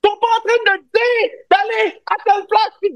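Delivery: shouting far too loud, very high; yelling without hearing himself; too loud, headphones on. Real, not acted. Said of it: "arrête de crier, [name], avec tes écouteurs".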